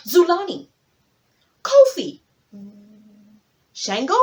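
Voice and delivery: high, loud hopeful guesses; eager guessing guests. Acted. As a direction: call out each name hoping it's right.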